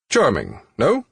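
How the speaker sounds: smug mid-Atlantic host showing off; mid-Atlantic accent. A narrator's reading. Older man to Jane on the island, lightly voiced mid-Atlantic accent. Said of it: "in that unplaceable mid-Atlantic accent".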